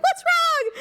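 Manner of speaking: high-pitched, lightly voiced mother; concerned mom. Mom asks her upset daughter, high mock concern. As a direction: imitate a mom's alarm.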